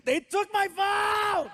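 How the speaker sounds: high, drawn-out mock scream; hammed-up yell. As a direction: imitate a kid wailing in outrage. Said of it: "he yells at the ground".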